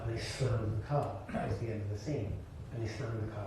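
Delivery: ordinary delivery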